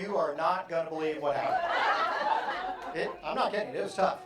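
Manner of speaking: excited storyteller. Acted. Acting the late storyteller.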